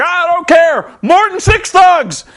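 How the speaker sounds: high, loud mock shout; indignant wrestler. Jeff Jarrett defying Eric Bischoff, high hammed-up shout. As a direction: ham up an outraged veteran's protest.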